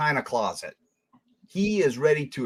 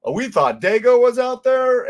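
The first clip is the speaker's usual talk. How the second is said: higher, louder "hold on" voice; imitating startled second thoughts, pitched up; mock alarmed objectors; 7 semitones higher, 4 dB louder than usual. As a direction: lightly voice people suddenly backing off. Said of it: "that they're like".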